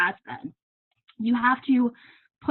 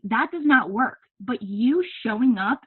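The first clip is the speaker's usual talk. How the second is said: normal talking voice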